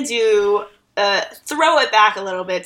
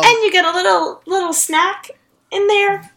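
high cutesy voice; 10 semitones higher than usual. Acted. Praising the Lunchables snack.

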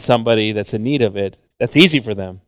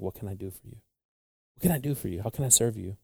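inner voice; 11 dB quieter, faster than usual. Retold or imitated. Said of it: "when we start to think".

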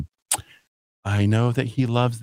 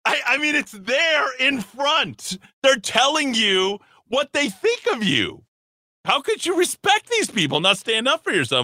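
sarcastic mock outrage. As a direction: ham up the outrage, pitched high. Sarcastic high mock rant.

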